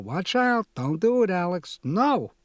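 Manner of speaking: high, loud frantic warning; remembered screaming. Retold or imitated. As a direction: yell it in panic. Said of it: "why are they screaming still".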